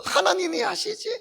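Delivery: high puppet asking lightly. Acted. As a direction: let the puppet ask it. Puppet voice vs preacher's answer. (높은 인형 목소리로 묻기)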